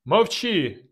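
high, sharp silencing yell; angry shout. Acted. Narrator shouting Dubatovk down. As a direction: cut him off with a shout. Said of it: "крикнув я".

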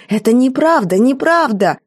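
high, pleading denial through tears; a maid denying the accusation, tearful and high. A narrator's reading. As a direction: insist it's not true, close to crying. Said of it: "Она всхлипнула".

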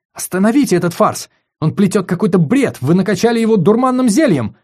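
loud, high, furious protest; indignant outcry. Acted. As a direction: demand they stop it. Lissandra protests angrily.

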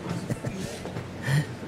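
airy, high scoffing laugh; short, mocking unvoiced chuckle; high breathy laugh. Scornful reaction to question.

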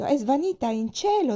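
high, loud wailing statement, cielo falling; lament. A narrator's reading. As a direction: keep wailing that she's gone to heaven. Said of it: "disse in un lamento".

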